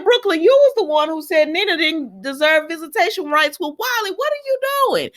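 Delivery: fed-up viewer yelling at the screen; exasperated scolding. Real, not acted. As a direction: call her out in disbelief, pitch climbing. Scolding a character on TV.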